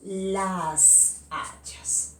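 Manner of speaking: Las hachas stretched out very slowly; slow clear example. Shown as a demonstration. Teacher answering her own question, very slowly. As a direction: spell out Las hachas by sound, unhurried. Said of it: "¿Cómo serían varias hachas?".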